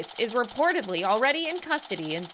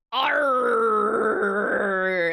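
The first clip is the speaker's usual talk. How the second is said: long, loud growled "arr"; pirate arr; 5 dB louder than usual. Shown as a demonstration. Streamer warming up to speak pirate, drawn-out arr. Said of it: "If I do that before I speak pirate".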